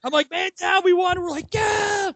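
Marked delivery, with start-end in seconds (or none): 0.3-1.2 high-pitched mock yell; retold yelling. Fallen fan and friends hollering after the win, mock yelling. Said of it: "we're all yelling". 1.5-2.1 high mock cheer; retold yelling. Friends all celebrating the Packers win, high mock yelling. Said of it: "we're all yelling".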